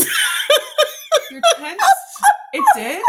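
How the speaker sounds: high, loud, laughing disbelief; amused shock. Real, not acted. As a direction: squeal the question in surprise. Shocked news tent collapsed.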